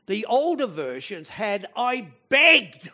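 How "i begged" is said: loud begging. Acted. Preacher voicing Paul's desperate plea.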